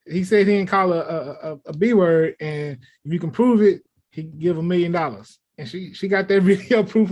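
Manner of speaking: ordinary talk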